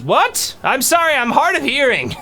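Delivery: put-on an old man straining to hear; old dwarf calling back, pitch up. GM plays old dwarf NPC.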